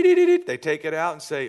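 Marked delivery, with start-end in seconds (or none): 0.0-0.4 high loud mock beeping of a thermometer; thermometer beeps. Pastor mimics the nurse's thermometer beeping, high and loud. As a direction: beep it out like a thermometer. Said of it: "and they go, dee".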